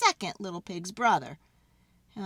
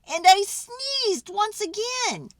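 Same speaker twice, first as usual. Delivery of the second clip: building to a sneeze; 11 semitones higher, 6 dB louder, slower than usual. A narrator's reading. Wolf sneezes again.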